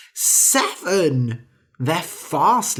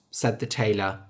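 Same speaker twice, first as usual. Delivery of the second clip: ordinary delivery, nothing marked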